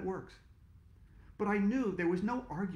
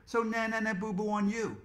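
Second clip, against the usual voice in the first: taunting; 6 semitones higher, 4 dB louder than usual. Acted. Teasing the listener.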